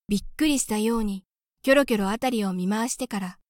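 plain reading voice

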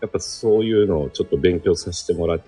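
normal talking voice

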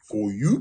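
小雪 with his signature drama intonation; 小雪 in his pet melody; special intonation. Shown as a demonstration. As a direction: say 小雪 the way he always imitates it. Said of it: "このイントネーションで何でも言うよ".

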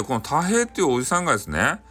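ordinary delivery

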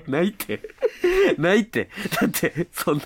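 talking through laughter; laughing. Real, not acted. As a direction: break up laughing on the words. Cracks up while talking.

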